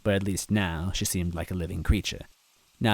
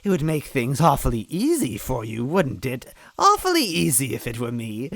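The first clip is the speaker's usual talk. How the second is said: high, taunting witch voice; Cackletta, sly villain; 8 semitones higher than usual. A narrator's reading. Villain mocking the girl, sly high witch voice. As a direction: purr the taunt like a cruel witch.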